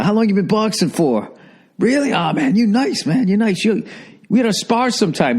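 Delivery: high-pitched hyped boxer flattering a rookie; gym boxer voice. Retold or imitated. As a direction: pitch way up, eager sweet-talking fighter.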